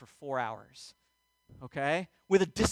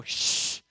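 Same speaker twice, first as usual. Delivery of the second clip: sharp shush. Retold or imitated. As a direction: hush them like a fed-up nurse. Acting out a scolding shush.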